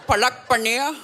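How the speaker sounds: exaggerated imitation of an Indian accent, item by item; mirrored Indian accent. Customer unwittingly mirroring the man on the phone, Indian accent. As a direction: order food in a put-on Indian accent. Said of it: "diesen Akzent oder Dialekt direkt zu spiegeln".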